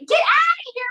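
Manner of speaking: very high, loud get out; shrieking shove-off. Acted. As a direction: shriek him away. Shoos the groping puppet.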